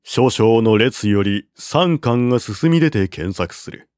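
ordinary delivery, nothing marked

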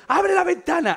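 very high, loud yell; panicked shout. Retold or imitated. Narrator yells at friend to open the window, very high and loud. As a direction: bark the order in a panic.

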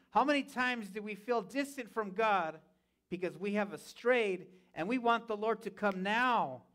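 usual speaking voice

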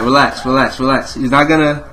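ordinary talk, nothing marked

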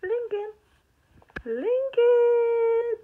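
high, loud sing-song call. Real, not acted. Calling the baby's name.

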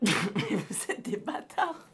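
slow words through laughter; laughing. Real, not acted. Streamer laughing at his chat's prank. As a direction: laugh it out, caught out.

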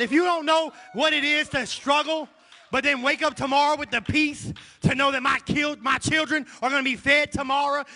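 loud, high, driving preacher cadence; preaching at full force. Acted. Pastor rousing the congregation, full-force preaching. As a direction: lift the church with every line.

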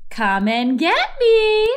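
little sister taunts from the pole; teasing. Acted. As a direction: dare him to climb up. Louise teases from the pole.

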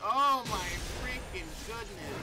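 loud, high groaning complaint; frustrated outburst. Real, not acted. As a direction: burst out over the maddening lag. Outburst as lag hits.

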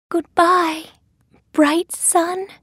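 very high little heroine voice; Thumbelina. A narrator's reading. Taking leave of the sun, very high heroine voice. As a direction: lift it high, in short separate phrases.

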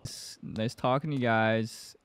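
ordinary delivery

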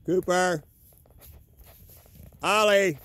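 high, loud falling calls; names hollered into the distance; calling the dogs. Real, not acted. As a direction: shout each name out across the field. Calling lost dogs outdoors.

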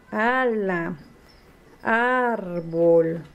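"Ala" and "árbol" with long opening a; slowly sustained initial a in "Ala, árbol"; initial-sound demo. Shown as a demonstration. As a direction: stretch the first vowel of "Ala" and "árbol". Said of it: "comienzan con la letra que hace A".

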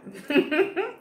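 high squealing laugh. Real, not acted. Laughing at son's catchphrase.

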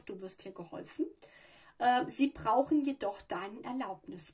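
ordinary talk, nothing marked

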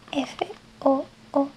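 "F O O D" spelled letter by letter, slowly; slow spelling for learners. Shown as a demonstration. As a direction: spell "F O O D" one letter at a time. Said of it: "Y se escribe así".

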